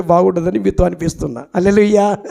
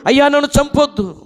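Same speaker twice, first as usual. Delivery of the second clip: pleading king; 8 semitones higher than usual. Acted. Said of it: "బతిమాలుచున్నాడు".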